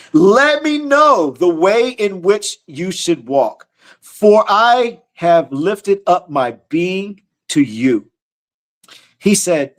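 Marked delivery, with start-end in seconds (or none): 0.1-8.1 drawn-out reverent reading of the verse; slow scripture reading. Acted. Preacher reading the psalm to his listeners, slow and reverent. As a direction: read each word slowly, like holy text.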